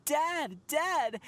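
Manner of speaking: calling out with a sudden burst; crying out Dad. Retold or imitated. Narrator voicing a boy who sees his lost father, crying out. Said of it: "he started crying out".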